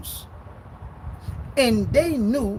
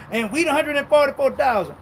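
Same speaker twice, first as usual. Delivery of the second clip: mocking boast; 6 semitones higher, 4 dB louder than usual. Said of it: "y'all run around talking about y'all".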